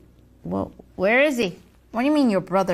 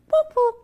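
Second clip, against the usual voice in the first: siren whoop; 12 semitones higher, 4 dB louder than usual. Acted. Siren noise imitation.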